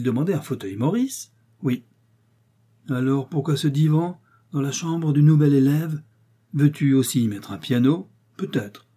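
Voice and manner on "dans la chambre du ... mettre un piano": ordinary delivery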